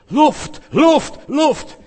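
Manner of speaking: very high, loud acted shouts; desperate cry for air. Preacher acting out choking for air, desperate shouting. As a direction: cry "Luft" as if suffocating.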